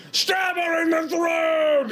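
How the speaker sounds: high, drawn-out mock shout; rowdy gang heckler. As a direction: heckle the stage fight from the crowd. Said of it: "Crawler gang members in full regalia".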